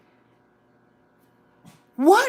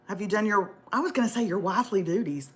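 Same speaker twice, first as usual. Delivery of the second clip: ordinary delivery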